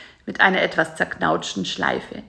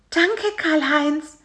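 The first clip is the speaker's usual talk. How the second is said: tender thanks; 9 semitones higher, slower than usual. A narrator's reading. Bisi tearful thanks.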